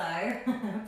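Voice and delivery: short light chuckle; a quick little laugh mid-sentence; brief amused giggle. Real, not acted. Amused aside while hosting class.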